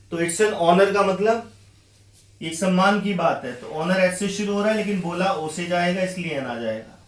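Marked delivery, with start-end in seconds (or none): none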